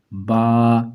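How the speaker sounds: با with the vowel stretched long; drawn out ba. Shown as a demonstration. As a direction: hold the aa of با. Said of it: "اسے کھینچ کے پڑھیں گے". (کھینچ کر با)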